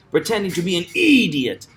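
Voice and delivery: "idiot" punched high on its first syllable; stress on ID. Shown as a demonstration. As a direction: hit the "id" in "idiot". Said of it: "Stress the id".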